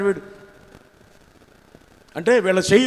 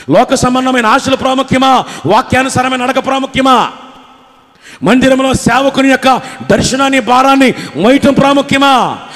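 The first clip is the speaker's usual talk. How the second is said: higher, louder barrage of rhetorical questions; fiery preaching; 6 semitones higher, 9 dB louder than usual. Acted. Preacher challenging the congregation, loud and urgent. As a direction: pound each question at the church.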